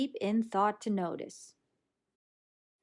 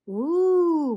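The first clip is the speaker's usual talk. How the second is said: high, loud gasp of wonder; amazed ooh; 8 semitones higher, 9 dB louder than usual. Acted. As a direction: gasp with delight. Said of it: "we gasp".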